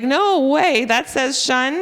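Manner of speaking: imitating children's loud, high disbelief; amazed kids. Teacher imitating kids amazed that TION says shun, loud and high. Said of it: "They're like".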